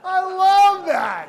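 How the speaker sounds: loud, high, drawn-out delight; big, high-pitched burst of approval; delighted shout. Real, not acted. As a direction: holler it slowly with real relish. Delighted reaction amid audience laughter.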